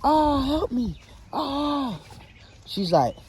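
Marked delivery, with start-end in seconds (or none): 0.0-2.0 high, desperate cry for help; man being eaten by dolls, high desperate cry; screaming for help. Acted. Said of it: "he's screaming".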